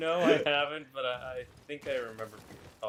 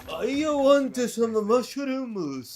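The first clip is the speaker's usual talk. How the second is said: slow, high fake Japanese accent, much louder; 13 semitones higher, 12 dB louder, much slower than usual. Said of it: "very fake Japanese accent".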